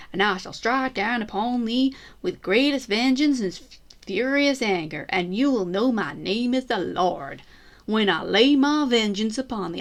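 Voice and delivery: a hammed-up Southern US preacher accent on the vengeance verse. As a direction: put on a Southern US accent for the scripture. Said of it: "I'm trying to sound sou-, southern U S A".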